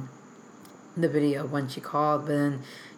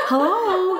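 high, loud comic greeting; funny phone voice; 9 dB louder than usual. Retold or imitated. Web team caller answering her, high funny voice. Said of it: "like, a funny voice".

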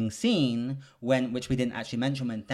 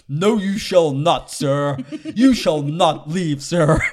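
loud, slow, high mock-Thor voice; slightly wrong copied voice; 5 semitones higher, 10 dB louder, slower than usual. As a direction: ham up a mock Thor imitation. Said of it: "Why are you doing that high voice".

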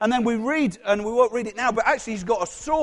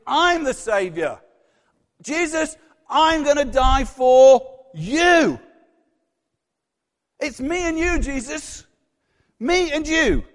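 slow, stretched, raised-pitch proclamation; grandiose declaration; 4 semitones higher, 5 dB louder, slower than usual. Acted. Peter telling Jesus he is the saviour, grandiose. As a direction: announce yourself as the hero, drawing words out. Preacher voices Peter's self-importance.

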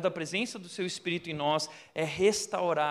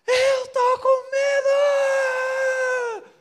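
very high, loud, drawn-out scream of fear; terrified scream; 9 dB louder, much slower than usual. Retold or imitated. Frightened woman grabs pastor's foot, screaming in terror. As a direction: shriek it out in panic. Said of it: "gritou assim".

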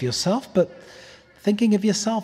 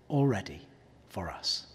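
breathy whisper, dropping away; hushed, almost breathless; 10 semitones lower, 11 dB quieter than usual. Real, not acted. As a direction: let it fall to a whisper. Preacher hushes on grace.

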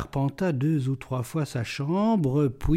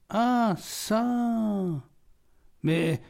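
astonished exclamation; 6 semitones higher, 3 dB quieter, much slower than usual. A narrator's reading. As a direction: exclaim in mild astonishment, stretching it out. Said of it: "s'écria celui-ci".

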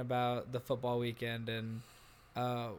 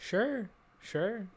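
sarcastic; 8 semitones higher than usual. Acted. Sarcastic agreement.

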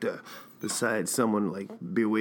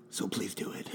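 whispered plea; 2 semitones lower, 9 dB quieter than usual. Pretend. Pleading listeners.

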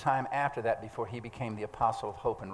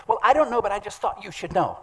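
quoted church lady; 8 semitones higher, 5 dB louder than usual. Retold or imitated. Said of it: "She said".

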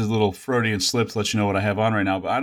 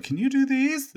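wife's question voiced much higher; imitating wife. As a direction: lightly mimic the wife asking, pitched way up. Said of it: "and she was like".

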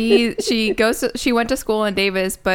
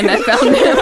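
louder, laughing confession; 6 semitones higher, 5 dB louder than usual. Real, not acted. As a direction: break into real laughter while confessing. Laughing at own stalking success.

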